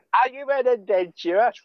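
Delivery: put-on high, bright little kid question; Skyrim kid voice. Gamer quotes a Skyrim child pestering the player, high kid voice. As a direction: pipe it up like an eager game child.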